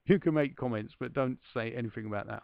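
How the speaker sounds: ordinary talk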